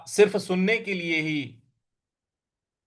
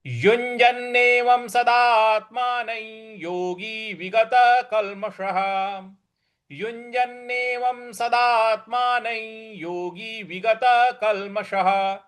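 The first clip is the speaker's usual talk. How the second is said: higher, measured chant of the whole line; full line recitation; 5 semitones higher, much slower than usual. Shown as a demonstration. Teacher recites the complete line for students, measured chant. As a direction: recite the verse line evenly. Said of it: "इस प्रकार से इसको बोला जाएगा".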